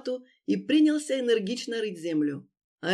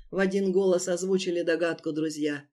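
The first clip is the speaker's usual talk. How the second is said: plain reading voice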